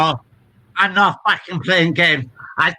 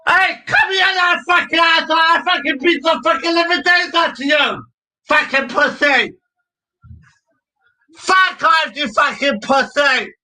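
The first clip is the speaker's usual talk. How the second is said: swearing tirade; 8 semitones higher than usual. Real, not acted. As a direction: yell the abuse at full force. Shouting at a man outside.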